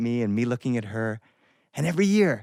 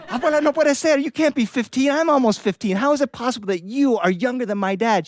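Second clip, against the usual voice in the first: put-on incredulous kid; 9 semitones higher than usual. Kid challenging grandmother.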